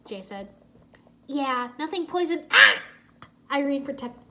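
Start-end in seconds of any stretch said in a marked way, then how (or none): 2.5-2.8 sudden loud fake gasp; mock choking cry. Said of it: "pretended to choke".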